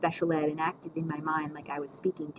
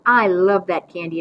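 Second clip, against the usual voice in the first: ordinary delivery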